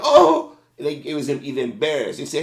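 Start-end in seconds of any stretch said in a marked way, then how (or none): 0.0-0.6 sudden high, loud startled "Oh!"; startled gasp. Retold or imitated. Sleeping driver jolted awake at window, startled loud yelp. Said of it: "They go".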